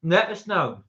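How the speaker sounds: talking with a mouthful of food; chewing while talking to chat. Real, not acted. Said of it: "I'm talking while I'm eating too".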